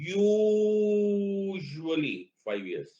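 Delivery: "usually" held out very long and high; long drawn "usually". Shown as a demonstration. Teacher stressing to class, long high "usually". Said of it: "ये यू इतना लंबा क्यों था".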